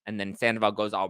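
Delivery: ordinary talk, nothing marked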